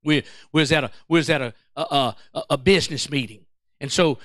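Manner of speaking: ordinary delivery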